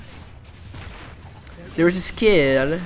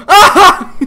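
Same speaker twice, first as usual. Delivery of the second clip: sudden shrieking laugh burst; loud laugh imitation; 14 dB louder than usual. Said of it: "and then he would go".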